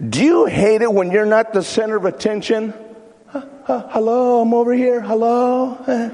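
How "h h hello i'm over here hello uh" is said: high needy calling out; silly clown. Pretend. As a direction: wave for attention, pitched up. Acting attention seeker calling out.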